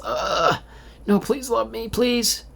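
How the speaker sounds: very high pleading gremlin squeak. Retold or imitated. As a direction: beg like a tiny gremlin, way up high. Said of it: "gremlin voice".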